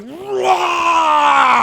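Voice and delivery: loud, high roaring scream; full-throated scare scream. Retold or imitated. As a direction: scream to make friends jump. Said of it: "I screamed at the top of my lungs".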